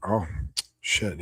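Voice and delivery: ordinary delivery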